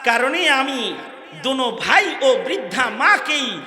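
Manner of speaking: high, loud declamation of a courtroom tale; high-pitched pulpit storytelling; impassioned preaching. Acted. Preaching at full force.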